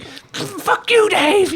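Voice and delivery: very high, strained mock yell; embarrassed kid at the taco counter, squeaky mock anger; mock outburst. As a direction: blurt it red-faced and flustered. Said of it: "He's like".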